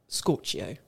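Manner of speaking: weather presenter voice. Acted. Weather catchphrase, put-on voice.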